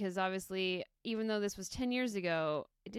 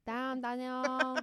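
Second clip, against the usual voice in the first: drawn-out, raised meme voice; Vine catchphrase impression; 4 semitones higher, much slower than usual. Trading Vine quotes with the co-host, catchphrase impression. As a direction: echo the catchphrase slowly, imitating the video. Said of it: "That was a Vine".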